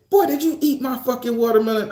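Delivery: angry mama. Retold or imitated. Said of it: "my mama said".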